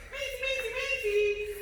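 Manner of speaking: high-pitched sing-song name calls; lilting high dog call. Real, not acted. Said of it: "High voice".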